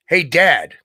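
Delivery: loud, bright greeting hollered down the phone; son calling out. Retold or imitated. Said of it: "and he says".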